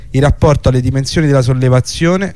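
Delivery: usual speaking voice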